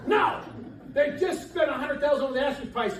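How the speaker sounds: high, loud laughing yell; exasperated realtor yelling put the toilet back; laughing mock shout. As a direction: shout it through laughter, hammed up. Retold outburst at sellers, laughing.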